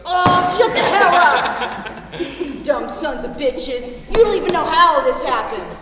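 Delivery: fed-up, blustering yell; angry outburst. Acted. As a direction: shout them down in exasperation. Old man fed up with hecklers.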